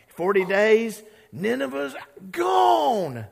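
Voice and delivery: high, loud, slow proclamation; gleeful doom sermon. Retold or imitated. Jonah gleefully preaches doom to Nineveh, loud, high and slow. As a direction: bellow the doom with relish. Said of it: "he's way too happy".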